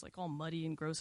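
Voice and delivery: ordinary talk, nothing marked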